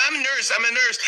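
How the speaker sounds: very high, imitated frantic woman; urgent rescuer. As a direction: announce yourself urgently in a high put-on voice. Woman rushes over to choking mother.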